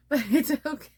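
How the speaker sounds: laughing the words out at herself. Real, not acted. Vlogger laughing at her own clumsy video, laughing. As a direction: giggle through it, embarrassed.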